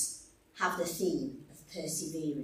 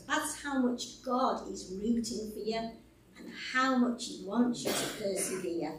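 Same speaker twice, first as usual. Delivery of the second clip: normal talking voice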